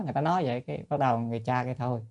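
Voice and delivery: ordinary talk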